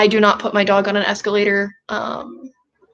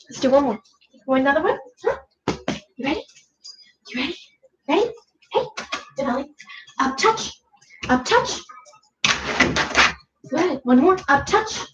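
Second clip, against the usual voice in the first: talking to a dog; 4 semitones higher than usual. Real, not acted. Cueing dog.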